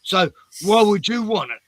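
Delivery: high, loud scandalised shop worker; indignant Karen. Retold or imitated. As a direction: play her outraged and accusing. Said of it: "And she goes".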